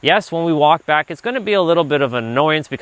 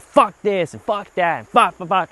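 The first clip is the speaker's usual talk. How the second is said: mock cursing; 5 semitones higher than usual. Shown as a demonstration. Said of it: "lots of F words will come out".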